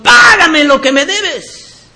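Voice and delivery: high, drawn-out demand for payment; harsh creditor's demand. Acted. Choking servant demands his money from a fellow servant, forceful demand. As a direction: demand it forcefully, stretching the first word. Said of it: "ahogaba diciendo".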